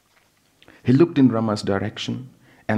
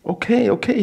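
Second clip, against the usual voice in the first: hasty reassurance; 13 semitones higher, 4 dB louder than usual. Acted. Husband answering frantic wife.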